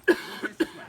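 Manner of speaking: sudden loud barked command; drill-sergeant yell to line up; yelling order. Acted. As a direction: shout it at the scouts. Leader yelling at campers to face.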